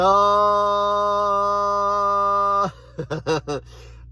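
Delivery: long high loud laughter. Real, not acted. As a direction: burst out laughing. Laughs after catchphrase.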